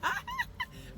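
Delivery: high burst of laughter; real laugh. Real, not acted. Wife laughs at her husband's answer on live, laughing.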